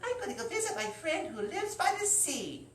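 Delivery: put-on high, light leprechaun girl voice; chirpy little leprechaun; Lucy's voice. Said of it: "She's like".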